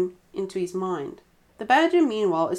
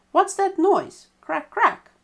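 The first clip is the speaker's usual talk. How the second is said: much higher, louder puzzled badger; badger asking; 12 semitones higher, 6 dB louder, slower than usual. A narrator's reading. Badger hears the flint striking, high puzzled question. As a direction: ask it in high alarm.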